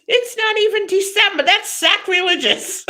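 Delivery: high, scandalized imitation of a dramatic Italian father; papa voice. Father declaring the early tree sacrilegious, in a papa voice. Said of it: "I do the papa voice".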